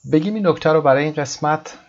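ordinary talk

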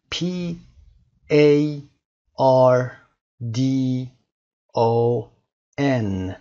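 letter by letter, a pause after each; spelling out. Shown as a demonstration. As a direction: spell it slowly on level, separate notes.

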